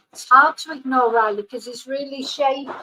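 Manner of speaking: ordinary talk, nothing marked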